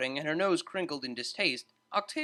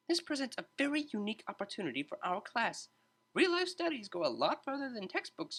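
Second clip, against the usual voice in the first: high, bright-toned teacher breaking up a fight; a lively tutor stepping in to calm two students; cheery tutor voice; 7 semitones higher, 4 dB quieter than usual. A narrator's reading. Tutor calming arguing students, high cheerful voice.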